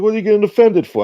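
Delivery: high, loud, quick challenge; retold exasperated retort. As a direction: imitate a friend firing back, fast and high. Acts out friend's retort in argument.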